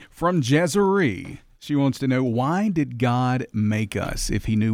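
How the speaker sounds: slow, drawn-out on-air announcing; radio voice. Real, not acted. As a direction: read it like a radio host. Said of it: "you just heard it".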